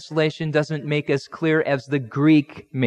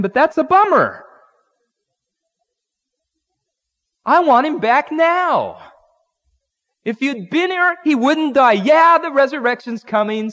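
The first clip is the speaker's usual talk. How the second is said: whining Martha; 9 semitones higher, 5 dB louder than usual. Retold or imitated. Martha voiced whining for brother.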